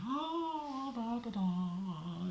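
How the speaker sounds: high, slow, dreamy sing-song; daydreaming kid. Retold or imitated. Kid in left field humming to himself, high and dreamy. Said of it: "I'm like".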